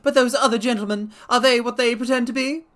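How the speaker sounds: very high, loud threatening squeak; frightened fat patient warning intruders, very high; reedy, quivering voice. Acted. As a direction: threaten from the dark in a high panicked voice. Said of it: "reedy, quivering voice".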